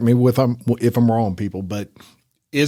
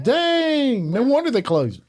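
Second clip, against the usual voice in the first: amazed reaction; 10 semitones higher than usual. Retold or imitated. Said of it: "I'm like".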